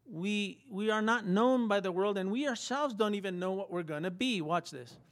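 ordinary delivery

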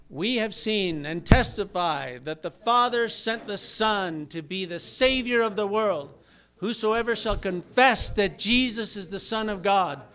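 loud, slow, solemn Bible reading; scripture reading. Acted. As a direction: proclaim the verses aloud to the church. Reads scripture aloud with congregation.

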